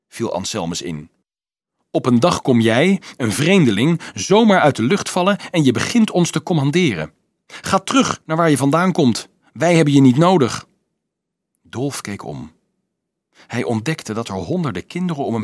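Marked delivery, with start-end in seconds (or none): 1.9-10.7 loud, high indignant outburst; forceful, outraged dismissal; angry accusation. A narrator's reading. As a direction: drive the intruder off with raised voice. Angry accusation, ordering rival away.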